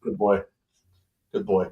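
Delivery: low, soft praise; soothing the dog. Real, not acted. Praising the energetic dog, soft and soothing.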